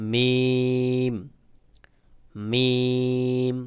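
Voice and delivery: মিম with lips slightly pressed, drawn out; slow, sustained Arabic মিম. Shown as a demonstration. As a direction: press the dry parts of the lips on a long মিম. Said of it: "চাপ দিয়ে উচ্চারণ করবেন".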